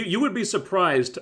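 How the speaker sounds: ordinary delivery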